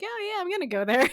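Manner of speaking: high, breezy mock promise; airy pretend promise; mock casual promise. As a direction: toss it off lightly, way up high.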